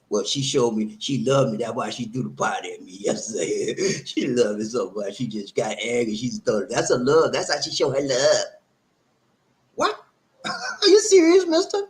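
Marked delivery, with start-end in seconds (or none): 0.1-6.7 mock deluded boyfriend. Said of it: "for those of you that think that". 9.8-11.8 high, loud, stretched-out disbelief; incredulous mock voice. Mocking the deluded listener.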